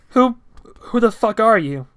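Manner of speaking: loud, high frightened yell; panicked shout. Acted. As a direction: yell it in fear.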